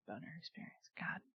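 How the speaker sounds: breathy unvoiced whisper. Real, not acted. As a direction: whisper it under your breath. Whispered aside.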